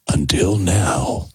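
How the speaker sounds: low, slow trailer announcer; deep-pitched, drawn-out movie promo voice. Acted. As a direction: draw it out like a film trailer teaser. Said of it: "That's the trailer voice".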